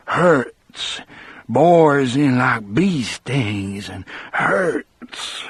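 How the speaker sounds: slow, frightened Southern character voice; scared man dwelling on the hurt. A narrator's reading. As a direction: draw the words out slowly, pained and fearful. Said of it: "he looked and sounded scared".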